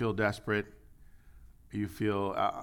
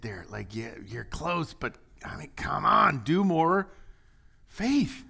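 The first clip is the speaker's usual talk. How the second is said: urging encouragement; 3 semitones higher, slower than usual. Acted. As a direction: coax them on, then urge harder. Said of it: "Or is he saying".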